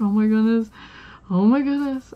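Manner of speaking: loud, rising freaked-out exclamation; retold panic. Cashier fears losing the job, loudly panicking. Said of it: "I was so freaking, I mean, I was flushed".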